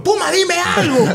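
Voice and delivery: high shout at your partner; shouted plea. Retold or imitated. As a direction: yell for a comment on air. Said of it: "y le digo".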